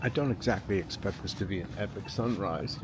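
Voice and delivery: ordinary talk, nothing marked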